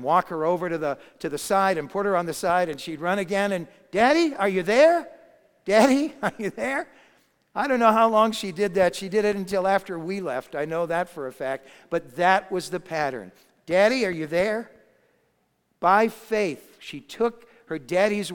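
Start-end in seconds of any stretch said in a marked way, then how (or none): 3.9-6.9 put-on high, loud little-girl call; little girl shouting. Little girl on a slide calling to daddy, high loud shout. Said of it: "loudest, littlest voice". 13.7-14.7 put-on higher, louder little-girl call. Little girl calling her daddy, lightly voiced. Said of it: "loudest, littlest voice".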